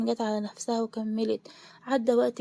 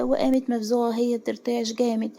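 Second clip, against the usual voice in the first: usual speaking voice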